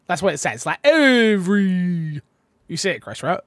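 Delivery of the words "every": "every" with the final E stretched out slowly; long E sound. Shown as a demonstration. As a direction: drag the last E of "every". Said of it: "one V and then a whole bunch of E's".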